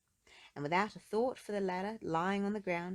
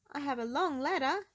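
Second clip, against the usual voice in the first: higher, eager helpful worrier; Mister Worry voice; 7 semitones higher, 4 dB louder than usual. A narrator's reading. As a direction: offer it anxiously, a bit higher. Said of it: "he added".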